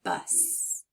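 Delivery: breathy, hushed "Bus"; softly whispered "Bus" while listening for g. Shown as a demonstration. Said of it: "at the end of bus?".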